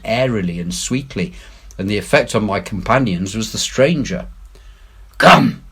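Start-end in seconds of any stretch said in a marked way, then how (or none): 5.2-5.6 struggling shaken word. A narrator's reading. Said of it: "struggling with his ashen lips".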